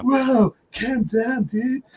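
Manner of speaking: high-pitched imitation of a dude; Keanu calming. Keanu calming Sandra after the cans, high mock soothing. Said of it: "the way Keanu's, like, laughing".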